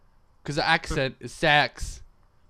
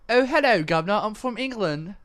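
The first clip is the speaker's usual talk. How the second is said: high, loud, posh-sounding mock British; mock British accent; 8 semitones higher, 4 dB louder than usual. Shown as a demonstration. Trying his British accent on his friend, hammed-up. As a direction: ham up a stuffy Englishman. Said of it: "let me just try my British one".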